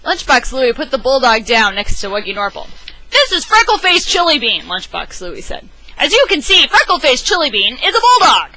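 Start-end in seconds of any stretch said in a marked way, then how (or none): 3.1-8.5 high, loud triumphant declaration; louder, higher proclaiming dad; loud proud announcement. Acted. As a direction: present it like winning an argument. Louie proudly presents the bulldog.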